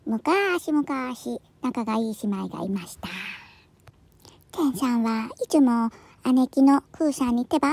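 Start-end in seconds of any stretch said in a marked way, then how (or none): 0.1-3.1 storybook opening. Acted. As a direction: open a fairy tale softly, stretching the vowels. Opens each cat tale.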